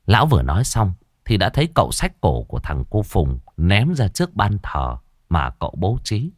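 plain reading voice

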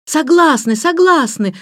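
put-on high, loud children's cry; eager shouted agreement. A narrator's reading. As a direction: shout out your surrender eagerly. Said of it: "закричали все хором". (радостно кричат в согласии)

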